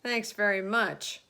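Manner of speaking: loud sarcastic snap; snapped. A narrator's reading. Fire chief snaps at the police chief, loud and sarcastic. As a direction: bite the thanks off sharply. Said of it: "snapped the head of the fire department".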